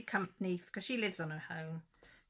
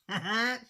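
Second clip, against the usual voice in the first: a high, loud little imitated laugh; retold giggle; 6 semitones higher, 11 dB louder than usual. Retelling how her aunt giggled at the teasing, imitated laugh. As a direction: mimic the aunt's giggle, brightly. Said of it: "she was laughing".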